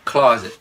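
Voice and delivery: "Closet" with CLAW loud, "zit" falling away; stress on first syllable. Shown as a demonstration. Teacher shows learners first-syllable stress, "Closet" punched up front. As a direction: hit the start of "Closet" hard. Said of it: "Âm nhấn đầu tiên ha".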